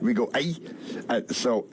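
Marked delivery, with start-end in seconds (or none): 0.3-0.6 "Aye" as the tight Scottish i sound; Scottish vowel. Shown as a demonstration. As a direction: say "Aye" with the Scots vowel of "bright". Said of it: "in Scotland we say bright light".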